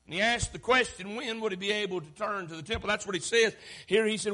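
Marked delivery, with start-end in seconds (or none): none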